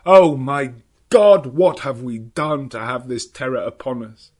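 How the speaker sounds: loud, slow, despairing outburst; husband crying out at the mark on his wife's forehead, anguished. Acted. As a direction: cry out in horror. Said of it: "threw up his arms with a cry".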